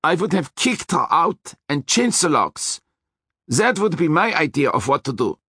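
menacing Austrian driver, high; threatening Austrian voice; Austrian accent. A narrator's reading. Hector continues, heated.

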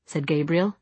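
ordinary delivery, nothing marked

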